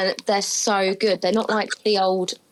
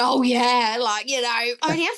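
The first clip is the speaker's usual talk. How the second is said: lager lout. Retold or imitated. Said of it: "acted like that lager lout".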